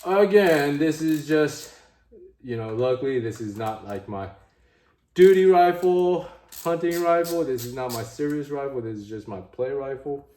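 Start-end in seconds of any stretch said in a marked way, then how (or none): 0.1-1.7 loud, high ranting start. Real, not acted. Vlogger ranting to viewers, loud and high. 5.1-7.4 loud, high, slowed emphasis; emphatic loud. Real, not acted. Ranting about the rifle.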